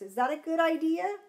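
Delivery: ordinary delivery, nothing marked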